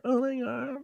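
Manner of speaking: high, soft parody moan; mock moan. Joking the moan will end up in an intro, high soft mock moan. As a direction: toss off the high fake moan softly. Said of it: "Could someone clip [name] doing that?".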